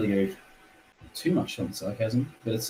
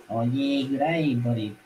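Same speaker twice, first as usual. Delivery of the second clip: a light mock broad Aussie "good day, buddy"; 4 semitones higher, slower than usual. Mocking how bogans talk, light broad Aussie. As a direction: lay on a thick Aussie drawl, just a bit. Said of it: "proper thick".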